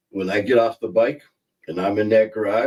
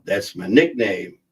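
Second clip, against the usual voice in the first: ordinary delivery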